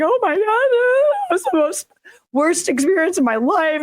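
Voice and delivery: high, teary imitation of her own sobbing; mock sobbing wail. Retelling her Lasik aftermath to the host, imitating a high sobbing wail. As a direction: replay the post-surgery meltdown as a high half-hearted wail. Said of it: "I'm like, crying hysterically".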